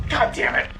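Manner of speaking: high, strained burst of exasperation; frustrated outburst. Real, not acted. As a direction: curse the game mid-rant.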